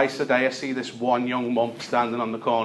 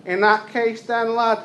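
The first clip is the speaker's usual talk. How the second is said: high, pleading scouse chancer voice; Smackhead Tony; 8 semitones higher, 3 dB louder than usual. Retold or imitated. Beggar at the door asks for tinfoil, high wheedling voice. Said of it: "he goes".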